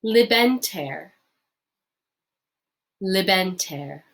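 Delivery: libenter said slowly and clearly; slow model word. Shown as a demonstration. Teacher modelling libenter for learners to repeat. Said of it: "our way of saying you're welcome".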